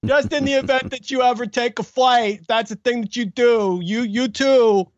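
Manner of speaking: high, slowing you too at the end; mock rationalizing. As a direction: justify it sheepishly. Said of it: "one of my favorite bits of his".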